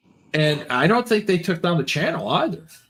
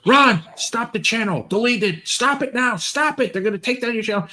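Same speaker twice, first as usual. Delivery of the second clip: higher, hurried mock alarm; urgent plea; 8 semitones higher than usual. Imitating Mick urging Ron to stop the channel. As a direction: beg him to stop the stream now. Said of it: "Thailand Mick goes".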